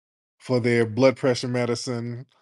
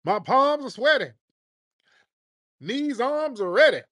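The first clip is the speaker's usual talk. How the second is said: high-pitched mock soul brother accent; mocking put-on soul brother accent, pitched way up; Barack Obama; 12 semitones higher than usual. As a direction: rap lines in a hammed-up soul brother accent. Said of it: "trying to talk with a soul brother accent".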